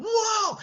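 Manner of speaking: very high, loud "whoa"; startled cry. Acted. Belle runs from the roaring Beast, high startled cry. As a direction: yelp as you turn to flee. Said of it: "that's when she's running".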